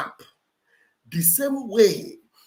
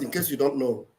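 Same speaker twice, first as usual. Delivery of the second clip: ordinary talk, nothing marked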